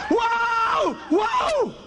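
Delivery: very high shouted wow; terrified cry. Retold or imitated. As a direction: yelp it in terror.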